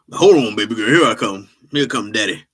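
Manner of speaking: put-on loud, eager old dad calling baby girl; hammed-up father rushing to help; lightly voiced old dad, loud and eager; old man voice.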